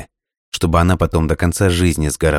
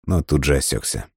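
ordinary delivery

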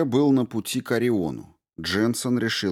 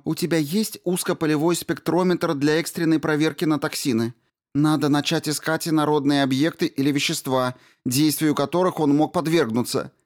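Rao's voice; 8 semitones higher than usual. A narrator's reading. Rao's voice continues.

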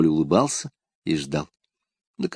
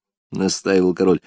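ordinary delivery, nothing marked

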